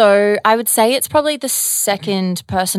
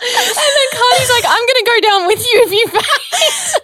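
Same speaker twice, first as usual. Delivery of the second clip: high, loud laughing; 8 dB louder than usual. Real, not acted. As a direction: laugh through every word. Laughing recalling the faint.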